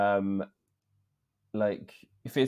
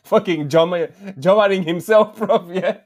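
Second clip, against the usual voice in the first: loud, high-pitched telling through real laughter; laughing disbelief; 7 semitones higher, 8 dB louder than usual. Real, not acted. Host confessing he served himself first, laughing loudly. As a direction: crack up at your own blunder. Laughing at own hosting blunder.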